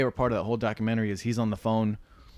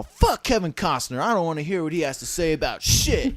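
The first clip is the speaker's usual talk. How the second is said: mock angry rant; 7 semitones higher, 5 dB louder than usual. Retold rant of the director.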